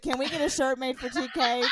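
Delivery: normal talking voice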